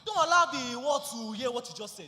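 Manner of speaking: very loud angry order; angry scolding. Acted. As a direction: shut her up with a shout. Husband tries to silence wife.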